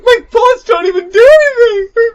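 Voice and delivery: high, loud panicked cry; squealing in game panic; mock panic. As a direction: wail it like you're helpless under fire. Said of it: "I'm like".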